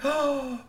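high, breath-filled gasp; shocked gasp. A narrator's reading. Storyteller before revealing what the troll did, gasping.

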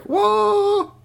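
very high startled whoa; shocked whoa. Acted. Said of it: "her hair stood on end like".

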